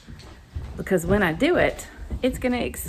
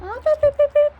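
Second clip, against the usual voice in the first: high, loud, rapid-fire beeps; chicken call; 8 dB louder than usual. Real, not acted. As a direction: call the hens with shrill staccato beeps. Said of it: "That's how I say, "Come here, chickens".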